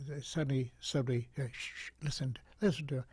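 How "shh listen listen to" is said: soft, calm shushing; calm hush. Retold or imitated. Quieting the screaming school crowd, soft and calm. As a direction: hush a rowdy crowd gently. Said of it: "I just spoke like this, in a very calm voice".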